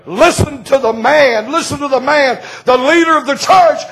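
high, shouted revival preaching; preaching at full force. Acted. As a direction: cry it out to the congregation.

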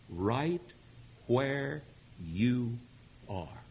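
"right, where, you, are", each word set apart by a pause; emphatic word by word. Real, not acted. Drives home the sermon's point.

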